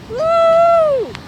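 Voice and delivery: high, loud whooping hoot; long, high excited woo. Retold or imitated. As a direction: whoop like a cheering crowd. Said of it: "went, woo!".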